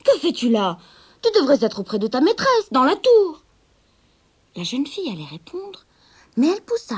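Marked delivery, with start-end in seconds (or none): 0.0-0.8 loud stern question, falling; scolding. Acted. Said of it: "gronda le vieil homme". 1.2-3.4 louder and higher, building to the end; scolding. Acted. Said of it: "gronda le vieil homme".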